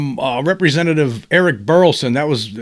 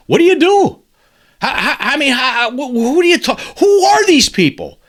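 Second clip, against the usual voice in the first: exasperated; 8 semitones higher than usual. Real, not acted. Exasperated ranting at secrecy.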